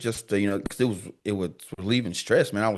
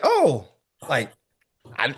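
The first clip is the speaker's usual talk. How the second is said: surprised realization; 3 semitones higher, slower than usual. Retold or imitated. Sudden realization in retold talk.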